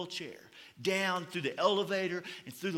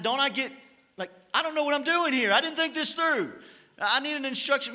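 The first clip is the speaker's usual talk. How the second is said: higher, louder panicked plea to the nurse; a new dad suddenly lost and begging for help; flustered plea; 6 semitones higher, 5 dB louder than usual. Retold or imitated. New father calling after vanished nurse, flustered and louder.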